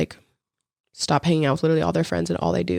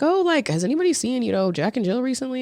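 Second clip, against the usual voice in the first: higher-pitched puzzled question; mock curious asking; 8 semitones higher than usual. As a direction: ask around as if puzzled, pitched up. Acts out a friend asking around.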